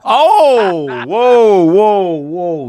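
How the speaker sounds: loud high shocked yell; shocked shout. Real, not acted. Shocked reaction to crude super chat.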